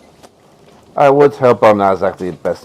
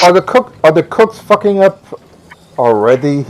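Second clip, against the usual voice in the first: mock indignant; 9 semitones higher, 5 dB louder than usual. Player joking in mock voice.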